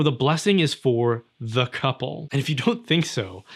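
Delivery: ordinary talk, nothing marked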